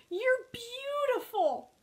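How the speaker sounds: high, slow swoon of admiration; gush, stretching the compliment; smitten. A narrator's reading. Porcupine falls for the hedgehog, smitten and high.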